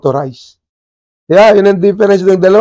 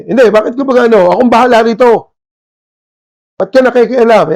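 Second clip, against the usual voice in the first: higher, louder mock swagger of a know-it-all; arrogant bully; 5 semitones higher, 3 dB louder than usual. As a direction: play the bossy man who answers to no one. Arrogant powerful man mocked.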